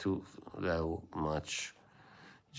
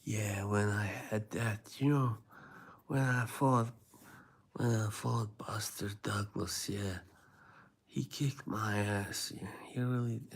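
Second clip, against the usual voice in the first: Mike Tyson; 3 semitones higher, 4 dB quieter than usual. Acted. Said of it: "I like listening to Mike".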